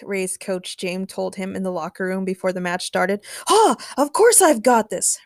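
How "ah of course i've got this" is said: boastful, higher and louder swagger; confident. A narrator's reading. Ray brags to his coach before the match, confident. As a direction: puff it up with confidence. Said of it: "Ray replied confidently".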